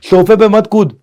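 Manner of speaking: loud high scolding; shouted no. Shown as a demonstration. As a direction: snap it like an angry parent.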